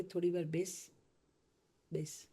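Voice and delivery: soft inner voice. Retold or imitated. Inner voice telling her to sit.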